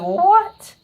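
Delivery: very high, loud mock-shocked squeal; incredulous outburst. Viewer reacting to the girl walking away, incredulous. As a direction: yelp it in exaggerated disbelief.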